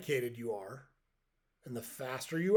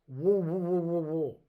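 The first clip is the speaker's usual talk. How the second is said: mumbled through a mouthful of worms, a bit higher and louder; mouth full of worms; 4 semitones higher, 5 dB louder than usual. Retold or imitated. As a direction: say it with your mouth stuffed full. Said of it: "spits a mouthful of worms out".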